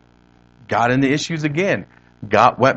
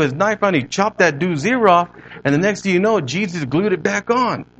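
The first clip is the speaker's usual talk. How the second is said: imitated East L.A. homeboy telling a tale, pitched up; East L.A. accent; 4 semitones higher than usual. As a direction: retell it as an East L.A. gang member, higher and animated. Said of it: "this is the way he said it".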